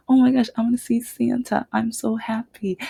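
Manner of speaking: high, quick, giddy burst; excited child. Retold or imitated. Boy waiting in line for Santa, imitated excitement. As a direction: imitate a kid bubbling over with joy. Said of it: "he was so excited".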